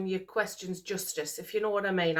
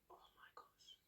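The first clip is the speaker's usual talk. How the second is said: barely able to get the news out, whispering; too moved to talk; 33 dB quieter than usual. Real, not acted. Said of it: "Guys, I can't even talk".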